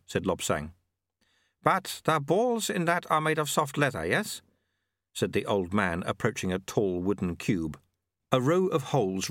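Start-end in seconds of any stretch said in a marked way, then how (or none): 1.6-4.4 put-on high, chirpy old man's voice; amused little old man teasing; old sweeper. A narrator's reading. Old sweeper gently mocks the shamed challenger, high and chirpy.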